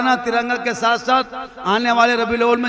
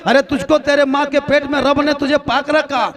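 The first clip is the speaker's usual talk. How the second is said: fervent sermon building to a high, loud shout; impassioned preaching; 4 semitones higher, 4 dB louder than usual. Real, not acted. Preacher charging the congregation to keep their mouths pure, impassioned preaching. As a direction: climb steadily into full-force, high-pitched exhortation.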